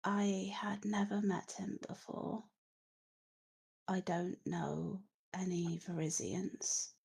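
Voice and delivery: faint, half-whispered corpse voice; hushed voice of the newly risen dead; dead woman answering. Acted. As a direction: answer softly, as if barely back from death.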